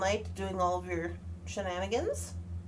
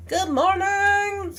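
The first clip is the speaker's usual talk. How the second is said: slow, sung-out good morning, higher and louder; drawn-out greeting; 9 semitones higher, 8 dB louder, much slower than usual. Real, not acted. Greeting the first viewers in chat, slow and sung out. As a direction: stretch the morning out.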